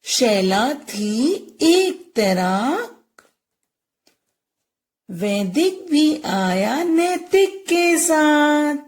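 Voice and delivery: put-on slow singsong rhyme for children; lilting, very slow teacher's recitation; poem recitation. Shown as a demonstration. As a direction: draw out each word of the verse. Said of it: "ए की मात्रा की कविता करेंगे".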